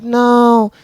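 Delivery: hint of a breezy girlfriend; mock brush-off. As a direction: wave it off with lazy nonsense. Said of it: "She's just like".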